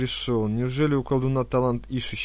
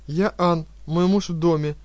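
fawning; 6 semitones higher, 6 dB louder than usual. Acted. Wife answers fawningly.

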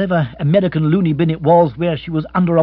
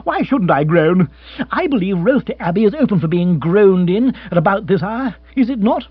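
indignant rant with sarcastic emphasis; self-control cracking, voice raised; exasperated bellowing; 8 semitones higher, 6 dB louder than usual. Acted. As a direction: push back loudly at being questioned. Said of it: "he bellowed".

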